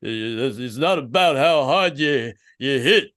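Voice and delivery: slurred, mumbling Philly boxer voice; Rocky. Acted. Boxer father lecturing his son, hammed-up Philly mumble. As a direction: mumble a lesson like a punchy fighter. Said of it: "He's like".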